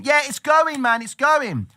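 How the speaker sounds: high, loud excited brag; hyped trader. Retold or imitated. Trader telling his partner Bitcoin is rising, high hyped voice. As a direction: gush about the price going up.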